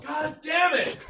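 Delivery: high angry yell; frustrated yell. Retold or imitated. Imagined man venting his frustration.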